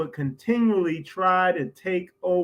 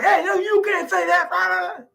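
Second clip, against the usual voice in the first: much higher, exaggerated voice of the other men cutting him off; mock alarmed objection; 11 semitones higher, 3 dB louder than usual. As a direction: squeak it out like nervous men hushing him. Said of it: "they ran up to him and like".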